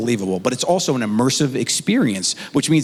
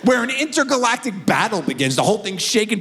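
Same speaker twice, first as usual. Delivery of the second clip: usual speaking voice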